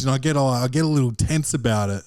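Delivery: ordinary talk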